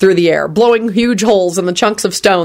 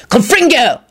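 higher-pitched spell cry; wild yell; 6 semitones higher than usual. A narrator's reading. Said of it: "Lupin yelled wildly".

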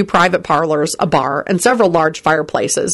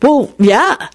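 embarrassed; 6 semitones higher, 3 dB louder than usual. A narrator's reading. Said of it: "in an embarrassed tone".

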